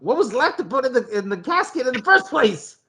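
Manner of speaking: high-pitched, baffled questioning; incredulous squeak of a question. Real, not acted. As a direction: pitch it way up in disbelief. Incredulous about the casket.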